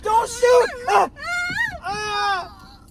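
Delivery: loud, very high pleading; hammed-up terror, shrieked high; acted panicked screaming. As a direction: scream as if facing a gun. Acting the shooting scene again.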